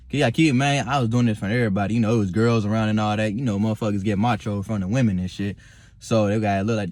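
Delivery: ordinary talk, nothing marked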